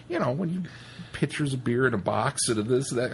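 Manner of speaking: ordinary delivery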